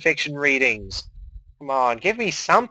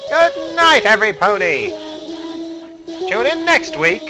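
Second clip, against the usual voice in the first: much higher, loud showbiz farewell; booming announcer sign-off; 8 semitones higher, 6 dB louder than usual. Acted.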